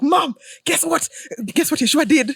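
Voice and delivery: high, loud, stretched-out call to mom; bursting excitement. Retold or imitated. Daughter phoning her mom with news of Yeshua, bursting excitement. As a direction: gush like you have big news.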